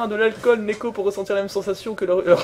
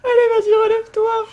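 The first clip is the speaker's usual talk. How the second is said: excited cheering. Real, not acted. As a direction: cheer your guy back up. Cheering the character up.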